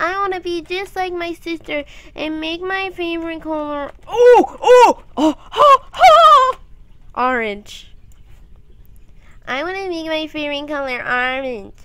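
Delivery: high-pitched mock little-kid whine; eager younger self. Telling how they copied their sister's favorite color, imitated high kid voice.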